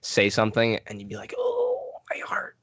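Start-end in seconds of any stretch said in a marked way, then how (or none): 1.4-2.6 high whispered wounded cry; mock heartbroken. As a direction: fake a broken heart, breathy. Mock hurt at sarcasm.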